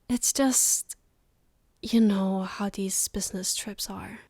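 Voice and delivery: ordinary delivery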